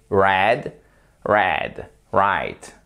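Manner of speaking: "Red", "red", "right" low, with American Rs; American R drill. Shown as a demonstration. Teacher warms up with learners, low American R in "red". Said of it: "bien vous chauffer".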